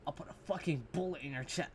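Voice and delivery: soft, mocking tough-guy imitation; weak cursing threat. Mocking a gunman who curses, weak threat. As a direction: mimic a villain trying too hard. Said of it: "You sound like a pussy".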